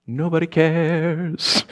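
high, hugely drawn-out comic dismissal; mock dismissive governor. Governor brushing off the accusers at the tribunal, mock dismissive. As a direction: stretch it slowly into a mocking singsong brush-off. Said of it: "So the government's like".

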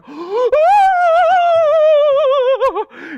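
very high, loud drawn-out horrified gasp; hammed-up long shriek of alarm; shocked shriek. Leader catching two teens in the stairwell, scandalised shriek.

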